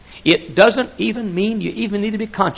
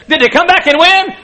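eager question; 13 semitones higher, 7 dB louder than usual. Retold or imitated. Wife asking about game.

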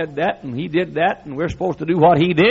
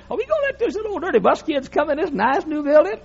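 deacon's complaint voiced much higher; mimicking the deacon; 12 semitones higher than usual. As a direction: do the worried deacon in a put-on high voice. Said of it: "He said".

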